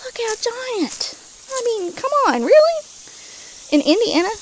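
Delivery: high, loud amazement; amazed. Real, not acted. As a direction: marvel at the size, rising. Amazed at giant elephant ears.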